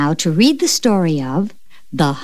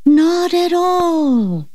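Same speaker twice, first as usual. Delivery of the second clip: much higher, slow eerie ghost reply; ghostly voice; 9 semitones higher, much slower than usual. Acted. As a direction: draw it out like a hovering ghost. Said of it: "the voice replied".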